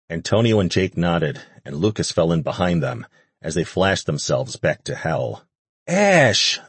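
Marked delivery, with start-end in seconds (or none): 5.9-6.6 high loud joyful cry; much higher excited shout; cried out. A narrator's reading. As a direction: call out as her mate appears. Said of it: "Elizabeth cried out".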